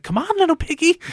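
high, loud, manic goading; crazed taunting. Retold or imitated. Knife-wielding villain chased to the roof, taunting manically. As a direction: imitate an unhinged villain baiting his pursuer.

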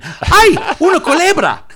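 high, loud, laughing shriek of fright; mock scream at a snake. A tourist in Mexico sees a snake and shrieks in mock terror. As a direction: squeal it like you just spotted a snake. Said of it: "You have to say it like that".

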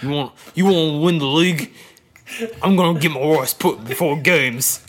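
loud, gritty British keeper act; mock British accent. Co-host as keeper urging teammates, loud mock British accent. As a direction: belt it out as a rough British goalkeeper. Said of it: "that's not my actual British accent".

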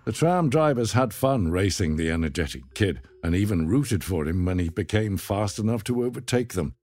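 plain reading voice

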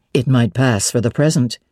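low, amused suitor. A narrator's reading. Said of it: "he said".

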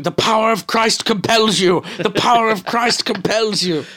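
high, loud mock exorcist chant; Exorcist priest. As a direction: shout it like an exorcism. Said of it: "start throwing holy water on the machine and going".

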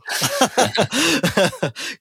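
bright burst of laughter; laughing at himself; real laugh. Real, not acted. Laughs at his own joke.

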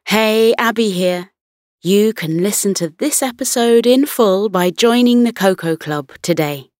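usual speaking voice

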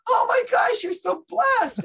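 high, loud mock gush, slowing on blessed; gushing audience. Audience member gushing at the psychic, mock awe. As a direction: ham up a starstruck believer. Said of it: "And they're like".

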